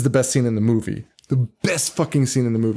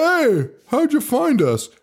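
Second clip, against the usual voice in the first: much higher-pitched mock greeting and question; imitated movie character; 11 semitones higher, slower than usual. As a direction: call it out high, playing Mo. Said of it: "And Mo's like".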